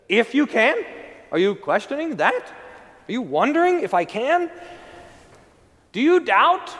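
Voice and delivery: Jesus throwing the father's doubt back at him; incredulous challenge. Acted. Jesus challenges the doubting father, incredulous and rising.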